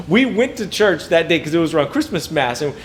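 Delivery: ordinary talk, nothing marked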